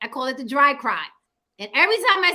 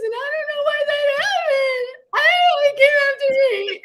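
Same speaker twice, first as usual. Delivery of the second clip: high-pitched, loud fake crying; 5 dB louder than usual. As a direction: wail through a phony courtroom cry.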